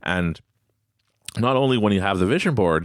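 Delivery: ordinary talk, nothing marked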